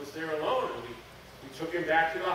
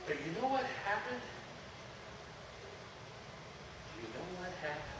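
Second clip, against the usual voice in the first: hushed awe; 7 dB quieter than usual. Acted. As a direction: drop to a reverent hush. Awed retelling of the healing.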